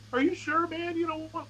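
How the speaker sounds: high-pitched mock worried voice; imitating concerned callers. Bandmates calling to check on him, retold in high concern.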